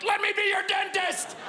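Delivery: high-pitched desperate scream; screaming at people. Acted. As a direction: scream it out in a frantic plea. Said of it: "screaming at people, just being like".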